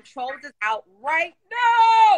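big, slow, rising-energy release shout; hype shout. Real, not acted. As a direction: stretch it out and shout it to the fans. Hyping the new release.

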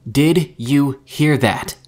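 slow, loud, word-by-word demand; enraged yell. A narrator's reading. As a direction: yell each word into the radio, furious. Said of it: "Mika screamed into the radio, nearly in a rage".

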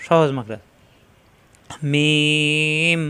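লাম and মিম held very long and slow; drawn-out letter names. Shown as a demonstration. Teacher drawing out letter names for students to copy. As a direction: stretch each letter name out slowly. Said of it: "জিহ্বার agar কিনারা উপরে দাঁতের গোড়ায় লাগিয়ে".

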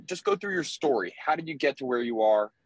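ordinary talk, nothing marked

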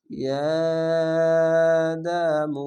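"ইয়া" held long on a level note; three-alif elongation. Shown as a demonstration. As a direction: draw out the vowel of "ইয়া" steadily. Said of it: "সেখানে তিন আলিফ টান হবে". ("ইয়া" তিন আলিফ টেনে পড়া)

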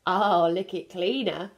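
ordinary delivery

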